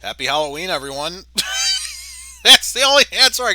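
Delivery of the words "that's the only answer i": laughing. Real, not acted. Laughing after joke.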